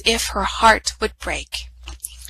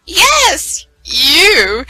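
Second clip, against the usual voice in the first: mocking; 8 semitones higher, 5 dB louder than usual. A narrator's reading. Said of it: "mocked the stepmother".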